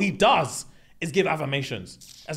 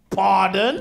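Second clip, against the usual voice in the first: loud, raised-pitch query; mock affronted shock; 4 semitones higher, 6 dB louder than usual. Girls reacting to friend dismissing Duncan, mock affronted. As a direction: imitate the girls taken aback.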